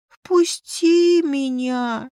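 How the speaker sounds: high, slow pleading whine; pitiful. A narrator's reading. Familiar stuck at the threshold begs Liza, pitiful. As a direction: plead piteously, stretching each word. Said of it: "послышался жалостливый голос".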